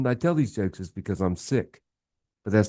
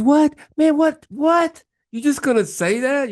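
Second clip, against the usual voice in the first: much higher and louder, shocked shouting; outraged disbelief; 6 dB louder than usual. Retold or imitated. Guy on vacation shouting at the news of his dead dog, in outrage. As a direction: yell it like you can't believe what you just heard.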